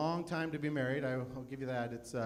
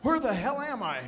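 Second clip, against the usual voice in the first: higher, louder, befuddled grandpa; confused old man; 8 semitones higher, 5 dB louder than usual. Retold or imitated. Comic acting out a confused old man, higher and louder. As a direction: sound lost and a little panicked. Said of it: "your father's or your grandfather's voice".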